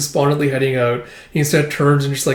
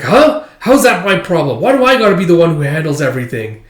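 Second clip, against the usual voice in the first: loud, raised complaint; indignant protest; 4 semitones higher, 5 dB louder than usual. Retold or imitated. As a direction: push the problem back on the caller. Acted phone quarrel over work.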